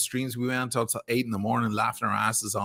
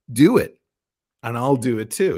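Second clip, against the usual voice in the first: retold defiant dare; 6 dB louder than usual. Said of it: "of course, I say".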